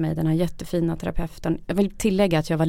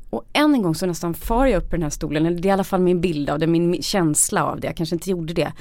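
normal talking voice

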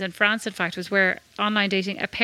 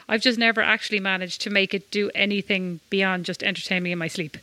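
normal talking voice

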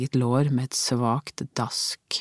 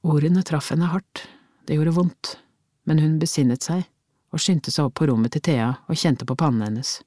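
ordinary delivery, nothing marked

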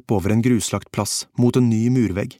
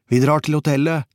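higher, raised voice calling out; called out; 5 semitones higher than usual. A narrator's reading. Kåre calling to his companion by the parked car, shouting. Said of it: "ropte han".

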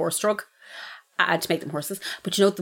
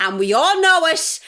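mock declaration; 10 semitones higher, 6 dB louder, slower than usual. Mock accusation.

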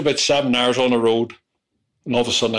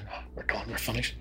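whispered, much softer no; quiet whispered brush-off; hushed refusal; 14 dB quieter than usual. Retold or imitated. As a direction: whisper it, shutting him down. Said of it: "I said".

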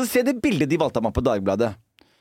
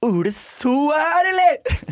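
put-on much higher, slow, rising drunken cry; drunk excited greeting; 14 semitones higher, slower than usual. Drunk at his surprise party, spotting Ole So, high excited cry. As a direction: squeal it like a drunk spotting a friend. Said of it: "Og så sier jeg sånn".

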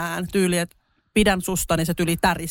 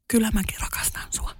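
soft whispered confession; whispered love confession; 4 dB quieter than usual. Retold or imitated. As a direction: breathe it into an ear at night. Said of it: "tää kuiskas mun korvaan".

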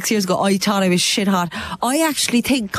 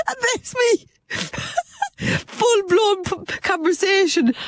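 laughing; 12 semitones higher, slower than usual. Real, not acted. Laughing at her own clip.

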